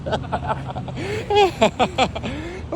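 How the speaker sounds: real very high rising laugh; high squeal laugh. Real, not acted. Vlogger cracking up at the runner, high laugh. Amused at man still running.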